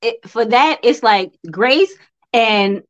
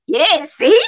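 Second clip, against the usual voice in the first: ordinary talk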